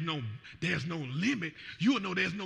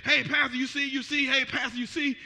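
higher, louder eager calling; eager elder; 5 semitones higher, 4 dB louder than usual. Retold or imitated. Elder excitedly grabbing pastor between services, higher eager calling. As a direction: call out to him excitedly.

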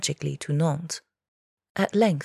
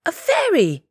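loud, high screech of alarm; panicked high yell; 13 semitones higher, 7 dB louder than usual. A narrator's reading. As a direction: screech it at the top of the voice. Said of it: "the tacksman screeched".

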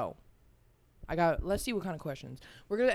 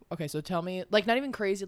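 usual speaking voice

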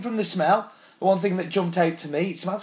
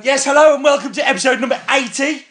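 excited; 8 semitones higher, 7 dB louder than usual. Pretend. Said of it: "Let's stop then".

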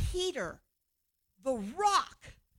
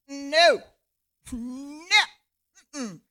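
mock refusal; 3 semitones higher, 9 dB louder, much slower than usual. Peter refusing the vision, loud and mocking. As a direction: refuse like a stubborn kid. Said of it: "what's the man of God doing".

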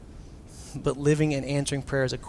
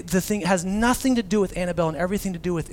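normal talking voice

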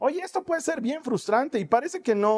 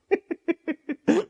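delighted burst of laughter; laughing; 7 semitones higher than usual. Real, not acted. Se ríe de la cita. (risa encantada)

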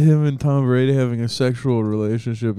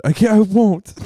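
higher, louder soap-opera defiance; melodramatic refusal; 8 semitones higher, 6 dB louder than usual. Pretend. Cohost plays Brady refusing Bill, soap-opera loud.

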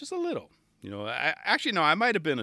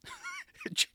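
high giggle; 6 dB quieter than usual. Real, not acted. Laughing at Giovanni's dance moves.

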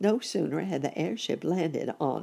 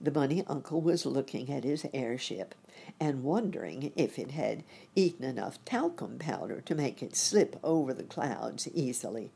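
usual speaking voice

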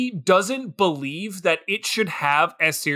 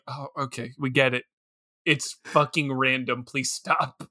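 a tired, quiet plea to make it stop; weary exasperation; 6 semitones lower, 6 dB quieter than usual. Retold or imitated. A viewer fed up with the movie's random jokes, quietly exasperated. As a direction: drop it low and soft, fed up.

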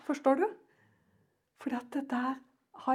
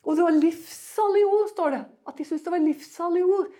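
loud, high proclamation; fervent preaching; 7 semitones higher, 10 dB louder than usual. Acted. Preacher proclaiming gracious words, loud. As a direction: ring it out to the back pews.

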